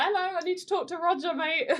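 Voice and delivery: high-pitched comic plea to get through; Jackie Stewart imitation. Pleading with security guards to reach Roger, high mock voice. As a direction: put on a high mock voice, amused. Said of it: "he's just trying to go like".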